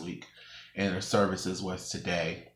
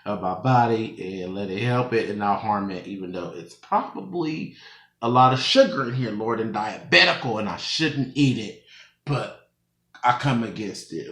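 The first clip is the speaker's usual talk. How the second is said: quick casual prayer over food; praying; 4 dB louder than usual. Real, not acted. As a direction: pray over the drink earnestly. Prays over the pop.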